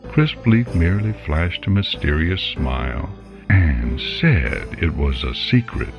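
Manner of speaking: plain reading voice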